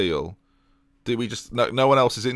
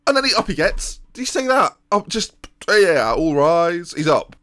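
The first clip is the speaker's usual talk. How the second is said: exasperated mockery; 8 semitones higher, 4 dB louder than usual. Real, not acted. Mocking keeper getting up late.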